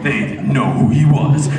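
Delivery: low trailer narrator; mock-epic film promo; movie trailer voice. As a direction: intone it deep and dramatic. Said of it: "that movie voice".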